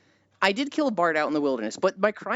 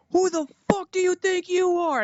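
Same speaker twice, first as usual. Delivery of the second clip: very high-pitched outraged orc; mock belligerent orc; 13 semitones higher than usual. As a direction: challenge them like an affronted orc, pitched way up. Acted orc confronting the player.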